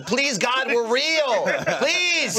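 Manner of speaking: high-pitched pleading cry; mock screaming. Digimon plead with Ken that they're real, mock screaming. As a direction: beg at the top of your range, hammed-up. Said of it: "they're just screaming".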